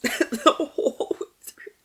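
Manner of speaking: breathy, teary laugh through words; half laugh, half cry; tearful laughing repeat. Real, not acted. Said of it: "I cry".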